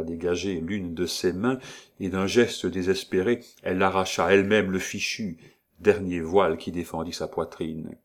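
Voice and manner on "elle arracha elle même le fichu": ordinary delivery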